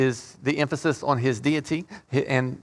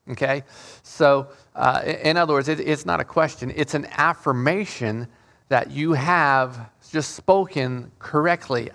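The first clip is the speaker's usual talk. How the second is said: normal talking voice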